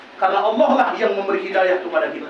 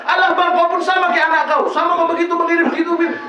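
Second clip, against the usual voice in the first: wife snapping at husband; 7 semitones higher than usual. Retold or imitated. Wife snaps at husband coming home, higher mock nagging. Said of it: "suami pulang kerja ngetok pintu".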